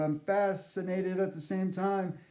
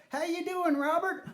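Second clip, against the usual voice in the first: high granny greeting; old granny voice; 11 semitones higher than usual. Retold or imitated. Nana greeting the wrong grandson, high granny voice. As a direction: chirp it like a doting old lady. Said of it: "And she's all".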